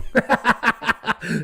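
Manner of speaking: a short high laugh at his own joke; real laugh. Real, not acted. Host laughs at himself after scolding listeners, short real laugh. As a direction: laugh it off after teasing the audience.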